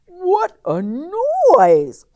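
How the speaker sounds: high, loud and slow outcry; a rudely woken pig complaining; startled pig. A narrator's reading. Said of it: "cried the pig".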